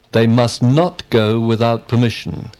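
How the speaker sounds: "not" stressed with emphasis. Shown as a demonstration. Student giving a negative example, stress on "not". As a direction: lean firmly on "not". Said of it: "we emphasize the word not".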